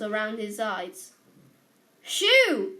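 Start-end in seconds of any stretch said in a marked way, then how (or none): 2.0-2.8 sharp, loud, high shout at a cat; loud shoo. A narrator's reading. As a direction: bark it to chase the cat off. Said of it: "said Mister Dursley loudly".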